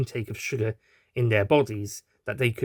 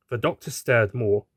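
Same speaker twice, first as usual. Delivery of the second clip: ordinary delivery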